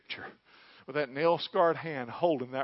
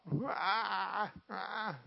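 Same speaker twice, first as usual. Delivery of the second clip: imitating a bleating sheep; mock sheep cry, thin and high; 5 semitones higher than usual. Preacher mimics a stuck sheep's cry, bleating. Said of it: "they would start to cry out".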